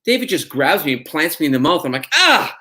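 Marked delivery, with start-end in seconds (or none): 2.1-2.5 short high, loud "ah"; mock yelp of shock; startled yelp. Actor retells being suddenly kissed on set, startled yelp.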